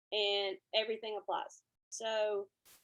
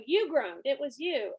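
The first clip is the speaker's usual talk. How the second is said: louder, higher mock accusation; playful accusation; 3 semitones higher, 7 dB louder than usual. Teacher catching the groaning kid, playful loud accusation. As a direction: point the finger, playfully loud. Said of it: "And then I go".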